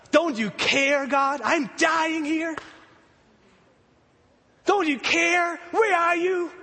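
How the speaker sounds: desperate plea. Retold or imitated. Believer crying to God in a storm of life. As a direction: cry out to God as it builds.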